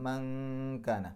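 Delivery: മങ്കാന drawn out very slowly with a hidden humming n; ikhfa nasal. Shown as a demonstration. Teacher slowly demonstrating ikhfa. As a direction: stretch മങ്കാന and hum the n into the k. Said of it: "മറച്ചു വെച്ചുകൊണ്ട് മണിക്കുക".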